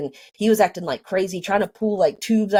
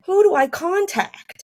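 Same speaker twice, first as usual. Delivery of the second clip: very high, helpless retold question; frantic wondering; 12 semitones higher than usual. Alone at the hospital thinking, frantic and high. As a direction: voice shoots up, at a loss.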